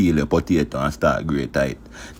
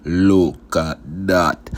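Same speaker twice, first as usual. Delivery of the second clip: drawn-out, louder proud catchphrase; slow catchphrase; 3 dB louder, much slower than usual. Acted. Cook showing viewers the dish, slow proud catchphrase. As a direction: stretch every word slowly, showing off the dish.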